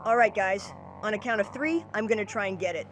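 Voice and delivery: hushed plan. Acted. Boy quietly tells friends the plan. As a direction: keep it down so it doesn't hear. Sneaking up on monster.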